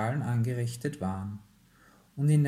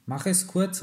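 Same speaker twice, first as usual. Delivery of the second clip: higher, mildly raised call; calling after; 7 semitones higher, 4 dB louder than usual. A narrator's reading. Father calls after his daughter at the door, calling after. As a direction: call it after someone leaving. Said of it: "rief ihr der Vater hinterher".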